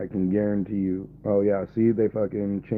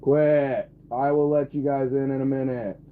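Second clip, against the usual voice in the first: higher, louder command; scolding the dog; 7 semitones higher, 7 dB louder than usual. Real, not acted. Streamer telling the dog to quit, loud. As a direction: bark it at the dog.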